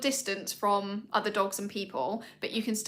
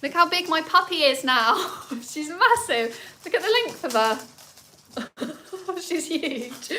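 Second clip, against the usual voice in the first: higher, louder, laughing delight at the puppy; excited doting; 7 semitones higher, 5 dB louder, slower than usual. Real, not acted. Owner showing viewers her big puppy, delighted and laughing. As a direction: gush over how big she is.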